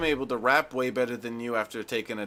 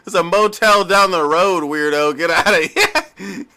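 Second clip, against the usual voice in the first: mock angry; 4 semitones higher, 7 dB louder than usual. Mocking Missy throwing him out.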